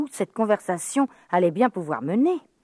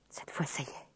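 whispered thought; 3 semitones lower, 15 dB quieter, faster than usual. Acted. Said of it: "pensa Sophie".

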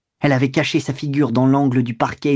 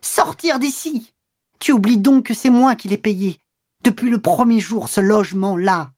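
bursting into fury; 8 semitones higher than usual. A narrator's reading. Woman who paid for the lodging, exploding with fury. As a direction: let the pent-up rage break loose, high. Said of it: "elle éclata".

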